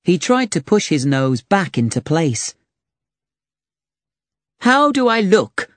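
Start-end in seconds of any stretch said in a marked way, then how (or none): 4.6-5.7 high, worried knight's question; a vain knight fishing for reassurance; anxious knight. Acted. Knight asks about melting face.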